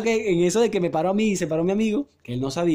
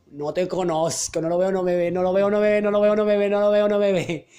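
monotone chant building louder and higher; nervous muttered mantra; 3 semitones higher, 4 dB louder than usual. Acted. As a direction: repeat it like a frantic spell to stay invisible. Panicked self-talk on seeing the manager. (letanía nerviosa que va subiendo)

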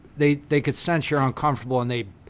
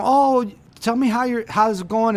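high, sing-song fake friendliness; mock chirpy small talk; 7 semitones higher than usual. Imitating a pushy guest asking how it's going, chirpy. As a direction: play the overly cheerful pusher. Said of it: "when people push".

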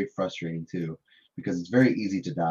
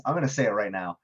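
ordinary talk, nothing marked

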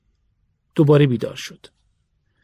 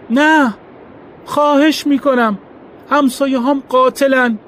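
much higher, louder panicked pleading; frantic cry; 12 semitones higher, 5 dB louder than usual. A narrator's reading. Terrified man begging the crowd, crying out in panic. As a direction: beg for your life in panic. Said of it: "ترکوفسکی داد زد".

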